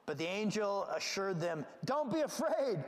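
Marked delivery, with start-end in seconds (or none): 1.8-2.8 exclaimed reassurance. Retold or imitated. Angel reassuring terrified shepherds, exclaimed. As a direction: call it out to calm them. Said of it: "Look at the exclamation point".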